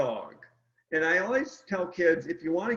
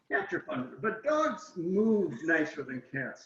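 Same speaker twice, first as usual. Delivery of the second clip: ordinary talk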